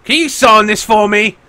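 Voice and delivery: high, loud eager fan voice; starstruck fan. Acted. Guard asking a wanted pirate to autograph his poster, high and eager. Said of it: "He's like".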